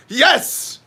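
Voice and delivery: sudden high excited yes; mock cheer of an excited chatter. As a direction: shout it out, thrilled. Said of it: "Greenfield's very excited about this".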